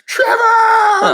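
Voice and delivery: high, loud delighted shout of a name; calling out. Retold or imitated. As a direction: yell across the hallway to an old friend. Dad calling out to Trevor Noah.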